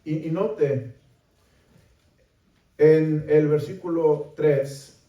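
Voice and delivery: ordinary talk, nothing marked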